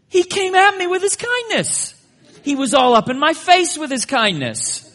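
loud, high-pitched comic indignation; mock outrage. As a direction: shout it like you've been attacked. Mock outrage retelling kindness to wife.

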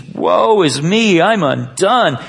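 loud, drawn-out cry of dread; prophet's cry. Acted. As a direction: proclaim it like a man overwhelmed. Quotes shaken prophet falling to knees.

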